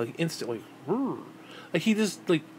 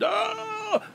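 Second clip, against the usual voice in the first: mock cry.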